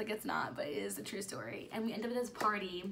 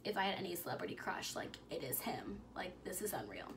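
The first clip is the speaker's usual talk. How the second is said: hushed retelling; 7 dB quieter than usual. Retold or imitated. Retelling a friend's celebrity crush, softly whispered. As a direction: let it drop to a secret whisper. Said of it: "Mallory literally was just talking".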